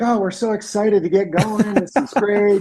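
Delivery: high-pitched, gushing imitation of eager clients; mock excitement. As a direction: act thrilled to get the job going. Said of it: "Everyone's like".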